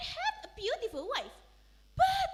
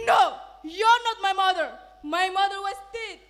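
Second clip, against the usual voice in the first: much louder flat denial; loudly; 13 dB louder than usual. Acted. Son denying his mother, loud. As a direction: shout her down. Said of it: "answered loudly".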